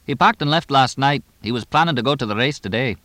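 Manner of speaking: chatty English trainer. Acted. Trainer reporting the boss's trip, lightly voiced brisk chatter. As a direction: lightly play a quick-talking trainer.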